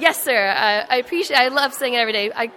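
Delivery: loud, brisk military reply; snapping to attention. Retold or imitated. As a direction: stand tall and bark it out cheerfully. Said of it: "I snapped to attention and said".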